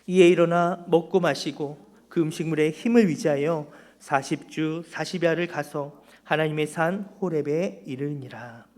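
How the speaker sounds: loud, formal Bible reading to the congregation; scripture reading. Acted. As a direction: proclaim the verses firmly, line by line. Reads the scripture passage aloud. (성경 본문을 힘 있게 봉독하듯)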